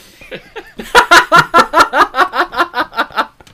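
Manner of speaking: high, loud cackling laugh; a long run of loud, high ha-has; big real laugh. Real, not acted. Friend cracking up at a joke about himself, loud laughter.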